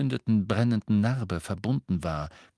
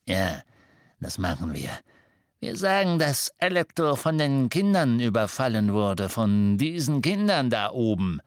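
scheming, sneering henchman; oily, plotting villain voice; sly Death Eater. Acted. Amicus plotting a lie.

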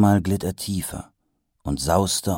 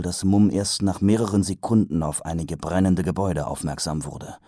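ordinary delivery

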